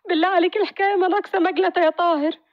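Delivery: high, pleading wife's voice; tearful wife. Acted. Wife pleads with joking husband, high and tearful.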